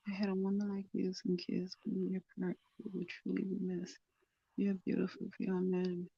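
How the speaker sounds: hushed, tender recital of a short love poem; soft poem reading. Acted. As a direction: read the poem softly, almost to yourself.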